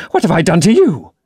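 high, panicked plea; cornered man begging, high and scared; frightened plea. A narrator's reading. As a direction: beg in terror.

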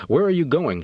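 mildly sharp challenge from a guard; demanding. A narrator's reading. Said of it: "she demanded".

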